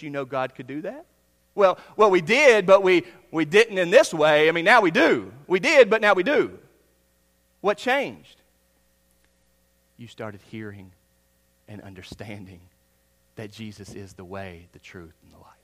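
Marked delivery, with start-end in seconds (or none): 1.5-6.6 louder, stammering reply; flustered townsfolk. Acted. Crowd answering the questioner, loud and flustered. 10.0-15.5 much softer and lower, tender; gentle explanation. Acted. Preacher gently answering the crowd, low and soft.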